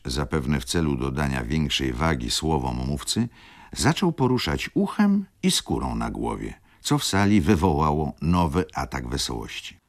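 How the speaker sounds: usual speaking voice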